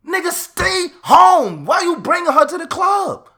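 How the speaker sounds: furious high shout; outraged shout. Acted. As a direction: bark at him across the club to leave her home. Shouting at a guy at the club.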